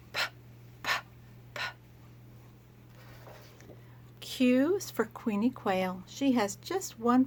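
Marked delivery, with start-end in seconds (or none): 0.1-1.7 short popped "Puh" sounds; letter sound p. Shown as a demonstration. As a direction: pop "Puh" out with the lips. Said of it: "And he says".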